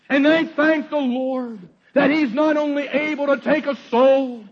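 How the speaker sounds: high, loud triumphant preaching; fiery preaching. Acted. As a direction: drive home the victory over sin. Preacher climaxes on deliverance.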